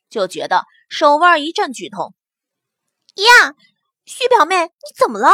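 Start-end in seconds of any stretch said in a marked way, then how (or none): 3.1-5.3 feigned alarm. Acted. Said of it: "义愤填膺地看着李奇雪".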